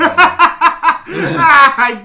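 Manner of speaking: high wheezing laugh through words. Real, not acted. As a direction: laugh helplessly while talking. Laughing hard.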